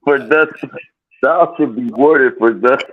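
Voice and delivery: high hammed-up archaic proclamation; mock old English. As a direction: proclaim like a mock medieval lord. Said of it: "Thou, thou".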